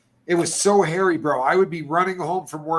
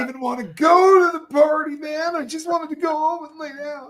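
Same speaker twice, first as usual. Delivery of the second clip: high, whiny pleading to the officer; high mock-innocent whining excuse; 11 semitones higher, 4 dB louder than usual. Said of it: "You sound like a bunch of jive".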